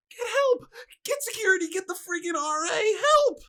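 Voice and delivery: high-pitched panicked shout, acted out; calling for help. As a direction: yell frantically for backup. Retold yelling to roommate for help.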